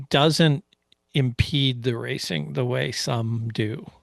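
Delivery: ordinary talk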